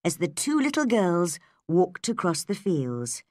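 usual speaking voice

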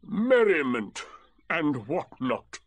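slow, loud, bitter sarcasm; aggrieved donkey spelling out a grievance. Acted. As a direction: draw out each word sourly. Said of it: "said Eeyore bitterly".

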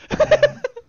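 loud laughing burst; high laugh. Real, not acted. Laughing after the yell.

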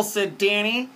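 ordinary delivery, nothing marked

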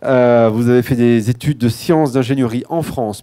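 usual speaking voice

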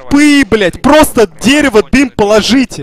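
loud, high furious yelling; raging gamer. Real, not acted. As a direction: bark orders in a rage. Raging at teammates mid-round. (орёт в ярости)

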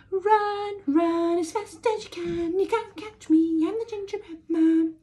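high, loud teasing chant; shouting taunt. A narrator's reading. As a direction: shout the taunt over your shoulder. Gingerbread man taunting again.

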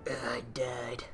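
whispered, unvoiced. Gamer whispering to viewers that he just died. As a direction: breathe it out like a ghost. Player killed in game.

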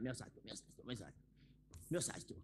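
muttered retreat. Acted. Old man retreats quietly.

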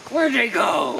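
lightly voiced old man; a hint of a creaky old man. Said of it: "you don't need the old man voice anymore".

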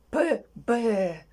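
П and Б sounded slowly, Б drawn out; sound demonstration. Shown as a demonstration. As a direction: model П, then a long Б. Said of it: "почав далі вимовляти звук". (повільна вимова звуків П, Б)

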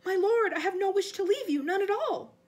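high, loud panicked protest; squealing, frightened servant; frantic denial. Acted. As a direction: deny it desperately to your master. Servant frantically denies wishing to desert.

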